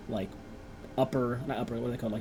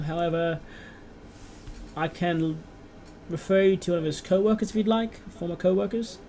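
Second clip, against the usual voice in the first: British clerk; 4 semitones higher than usual. Acted. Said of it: "make the clerk British".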